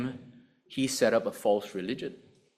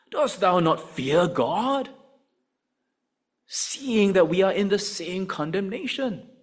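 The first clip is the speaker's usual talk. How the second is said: slow, higher rebuking voice; rebuke; 7 semitones higher, 4 dB louder than usual. Retold or imitated. A lightly voiced thief rebukes the other criminal, slow and stern. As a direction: scold him slowly, gravely. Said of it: "the other answered him and rebuked him".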